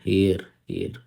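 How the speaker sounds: एयर with the h dropped; pronounce air. Shown as a demonstration. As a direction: say एयर as plain air, silent h. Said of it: "इसकी pronunciation आप होती है एयर".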